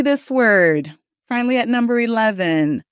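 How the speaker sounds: ordinary talk, nothing marked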